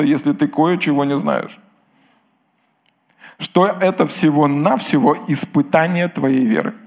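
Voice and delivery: ordinary delivery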